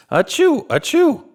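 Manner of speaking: two loud high sneezes. A narrator's reading. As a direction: sneeze twice, big and high. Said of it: "went Dasher".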